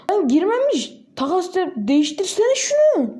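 high, slowed exasperation at the game; real annoyance, pitched well up; exasperated complaint. Real, not acted. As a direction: whine at the miss, drawn out. Shot missed again.

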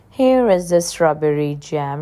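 ordinary delivery

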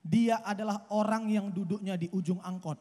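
ordinary talk, nothing marked

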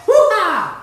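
loud, high whoop of delight; gleeful kitchen cheer; excited whoop. Real, not acted. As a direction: let out a big triumphant hoo-ha. Triumphant whoop while cooking.

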